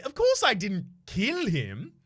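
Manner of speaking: high, drawn-out protest leaning on "kill"; stress on kill. Acted. Suspect denying the killing to a cop, stress on kill. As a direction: punch the word "kill", high and loud. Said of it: "the emphasis is on kill".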